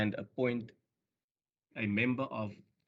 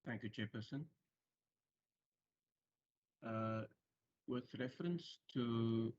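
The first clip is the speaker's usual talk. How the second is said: very faint, far from the mic; faint and hard to hear; barely audible; 9 dB quieter than usual. Real, not acted. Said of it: "Sir, your voice is very faint".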